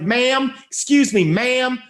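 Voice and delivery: high, prim and overly polite; polite fussy voice. Retold or imitated. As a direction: flag down the barista with exaggerated politeness. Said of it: "most polite, fussy voice".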